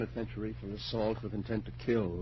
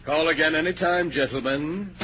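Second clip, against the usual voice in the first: louder and higher, sing-song taunting politeness; mocking farewell; 7 semitones higher, 7 dB louder than usual. Seeing the detectives out, a loud mocking farewell. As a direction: call after them with sneering cheer.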